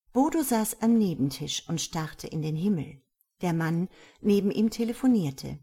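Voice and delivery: usual speaking voice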